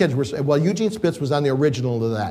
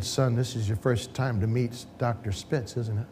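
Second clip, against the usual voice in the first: softer, slow fatherly question; old man, hand on shoulder, asking tenderly; gentle old man; 4 semitones lower, 9 dB quieter than usual. Retold or imitated. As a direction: ease into it like a kind old father. Old man comforts the young father.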